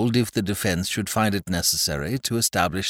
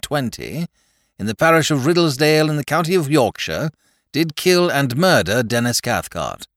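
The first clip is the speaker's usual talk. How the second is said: formal court proclamation; 6 semitones higher, 4 dB louder than usual. A narrator's reading. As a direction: read it out like a court clerk. Reads the formal indictment.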